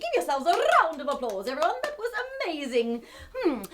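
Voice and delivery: ordinary delivery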